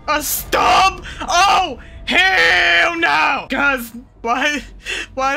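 high, loud horrified shouts; shocked outcry. Real, not acted. Player sees what the doctor shows him, shouting in shock. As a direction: scream it in real disbelief. Discovers his missing body part.

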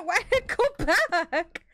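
laughing through the question; baffled giggle, slow. Real, not acted. Laughing at game glitch.

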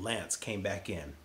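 usual speaking voice

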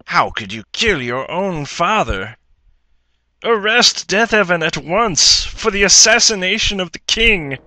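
loud, high, commanding accusation; accusing shout. Acted. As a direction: shout the order to arrest. Brother accuses, calls arrest.

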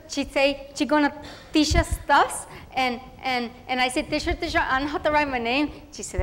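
eager chatty schoolgirl voice, Spanish mixed in; a proud first-grader telling her day in a rush; little girl Tere. Acted. Girl telling about her first school day, eager and chatty.

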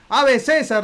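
loud, high ceremonial hail; hail Caesar. Pretend. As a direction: call it out like a crowd saluting. Hailing Caesar in costume.

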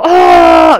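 high, loud sustained choral "aah"; mock choir cry. Friend's reaction to the insult, as a mock choir cry. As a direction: belt a mock gospel-choir gasp. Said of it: "como si fuese un coro de negros".

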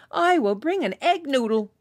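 high, loud proud call; cried out. Acted. Villager outbidding the others, high and loud. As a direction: shout it proudly over the crowd. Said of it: "cried another".

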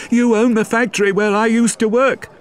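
high, loud accusing woman's voice; shouting woman. Acted. Fantine shouting at the mayor who cost her the job, high and loud. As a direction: shout the blame at him, high. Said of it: "she shouted at him".

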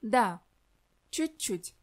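usual speaking voice

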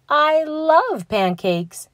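high, slow, delighted exclaim. Acted. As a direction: gush it out slowly. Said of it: "she exclaims on the phone".

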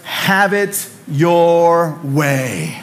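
drawn-out ad slogan; slogan read slowly. Retold or imitated. Pastor quoting the Burger King slogan, very slow. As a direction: stretch Have it your way like a jingle. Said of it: "Burger King's slogan was".

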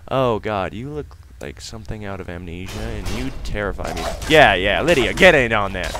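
usual speaking voice

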